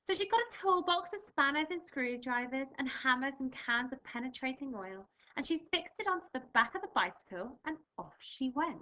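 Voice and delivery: lively storybook read-aloud; reading to children. Acted. Teacher reading a picture book to her class, lively.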